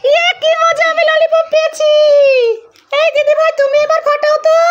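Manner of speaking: put-on high, bright little-kid cheer; child's voice. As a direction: squeal like a happy toddler. Parent voices child getting lollipop.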